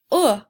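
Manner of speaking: short loud "uh" vowel on its own; isolated short oo sound. Shown as a demonstration. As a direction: give "uh" crisp and clear. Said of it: "If you said".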